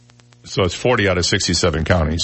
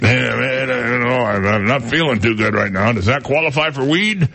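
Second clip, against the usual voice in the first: higher, lazy stoner voice, asking about weed; mock Missouri stoner; 4 semitones higher than usual. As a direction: ham up a dopey pothead. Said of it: "They all talk like that in Missouri".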